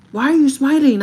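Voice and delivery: loud, high-pitched demand; upset boyfriend. Retold or imitated. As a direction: imitate a boyfriend confronting you. Acts out upset boyfriend confronting her.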